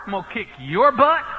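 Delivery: hammed-up fighting talk, rising at the end; mock angry threat. Retold threat to the other driver.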